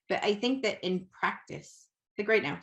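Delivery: ordinary delivery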